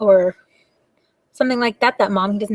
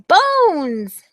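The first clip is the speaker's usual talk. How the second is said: ordinary delivery, nothing marked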